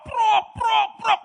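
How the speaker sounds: smack out the sound of stone blows; imitated thuds. Preacher imitating a possessed man striking himself with stones.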